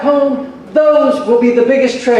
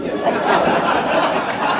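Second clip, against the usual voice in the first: laugh; 4 dB quieter than usual. Real, not acted. Laughs after joke.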